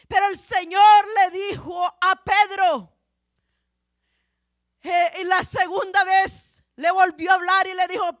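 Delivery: loud, projected sermon delivery; preaching. Real, not acted. Preacher recounts Peter's rooftop vision to the congregation, forceful preaching.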